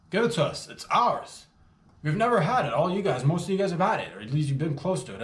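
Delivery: rally the team, pushing hard; loud rallying cry to the players; fired-up demand. Real, not acted.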